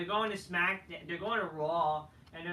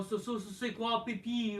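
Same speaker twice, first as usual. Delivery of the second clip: a lightly put-on French Canadian accent; mock French; 4 semitones higher than usual. A French Canadian star imitated in mock French. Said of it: "He talks in, in French".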